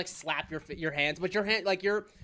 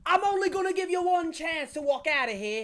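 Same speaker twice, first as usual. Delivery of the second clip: higher-pitched Western showdown voice; cowboy ultimatum; 8 semitones higher, 3 dB louder than usual. Acted. Boy taking cover behind the couch, high cowboy threat. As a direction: play the outlaw giving one last chance.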